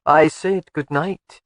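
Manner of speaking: slow drawl on "I said good night" with a lazy rise. Acted. Husband teasing wife who asks what he said, slow drawl. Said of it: "Alec drawled".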